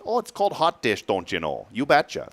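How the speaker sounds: mock Upper Midwest accent, starting high; playful Fargo impression. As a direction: do your friends' North Dakota accent. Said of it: "That's what they would say".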